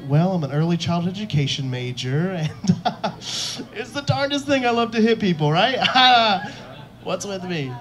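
hammed-up sweetness, pitch climbing into giggles; ridiculously nice fighter. Imagined MMA fighter chatting after the fight, absurdly nice and giggly. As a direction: play a chirpy, too-nice guy laughing at himself. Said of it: "ridiculously nice M M A fighters".

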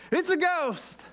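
high, frightened cry of ghost; terrified disciple shouting in the storm. Acted. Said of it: "they cried out".

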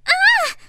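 sharp high yelp of fright; loud high startled cry; startled shriek. Acted. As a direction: jump out of your skin. Startled by sudden call.

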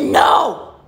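very loud, sudden no; booming refusal. Acted. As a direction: bellow a guardian's flat-out refusal. Guardian refuses the goat.

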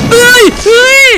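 sudden high, loud scream of fright; startled scream. Real, not acted. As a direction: yelp in real shock at the jump scare. Jump scare in horror game.

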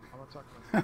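loud nerdy put-on voice; nerd voice. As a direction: go full geeky nerd. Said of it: "talk to my nerd voice".

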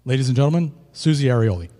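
loud, ringing stage introduction; big announcer intro. Real, not acted. MC bringing the singer on stage, loud announcer call. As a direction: call her name out to the back row.

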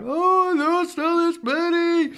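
hammed-up high whiny complaint; mock crying to dad. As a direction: whimper it like a sulky kid. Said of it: "I'd go crying to my dad".

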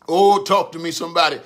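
usual speaking voice

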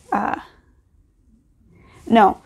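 ordinary delivery

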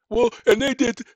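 mock someone launching into every detail; mimicked over-explainer; rambling litigant.

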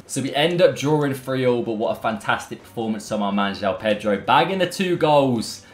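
ordinary delivery

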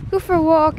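usual speaking voice

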